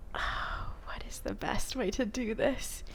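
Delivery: whispering. Real, not acted. Whispered aside before acting.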